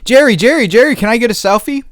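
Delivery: mock the pushy fan shoving a camera in his face. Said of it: "and the guy says".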